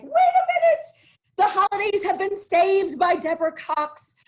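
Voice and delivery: high, loud mock-dramatic exclamation; excited outburst. Host retelling her reaction to the show news, excited outburst.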